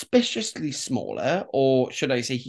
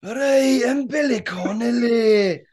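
put-on higher, louder Glaswegian accent; Billy Connolly impression; 8 semitones higher, 4 dB louder than usual. Host asked to do Billy Connolly, Glaswegian accent. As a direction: do a Glaswegian comic. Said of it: "you sounded just like Billy Connolly".

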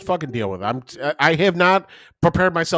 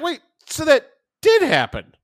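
mock surprise; 12 semitones higher than usual. Retold surprised question.

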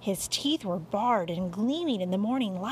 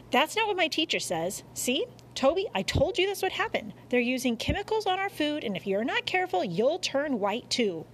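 put-on higher, quick know-it-all kid; argumentative boy; 6 semitones higher, faster than usual. A narrator's reading. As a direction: lecture them in a high boy's voice. Boy argues with his mother.

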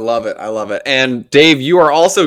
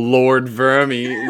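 mock British accent; 2 semitones higher than usual. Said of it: "you had a good one a second ago".

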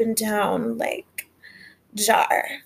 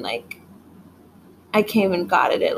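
usual speaking voice

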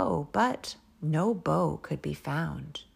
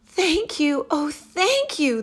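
high, loud heartfelt thanks; grateful cry; 10 semitones higher, 6 dB louder than usual. Acted. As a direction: gush with gratitude. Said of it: "the grateful witch cried".

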